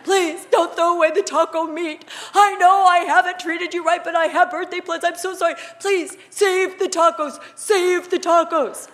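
high, loud, hammed-up pleading, slowing at the end; wife mimics her grovelling husband, loud and high; begging husband. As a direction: play the husband begging on his knees. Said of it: "on his knees repenting".

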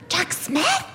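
high, questioning imitation; astonished echo. Friend learning whose daughter it is, astonished. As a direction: echo the name as an amazed question.

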